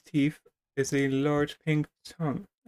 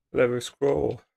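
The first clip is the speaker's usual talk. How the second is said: usual speaking voice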